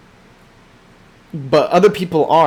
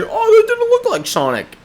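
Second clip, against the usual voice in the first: high mocking whine of a critic; mock high-pitched critic. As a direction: sneer it like a nitpicking fan. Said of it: "some people can sit there and say".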